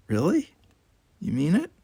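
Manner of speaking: hope he means it; Tanya touched by his apology; hopeful surprise. Acted.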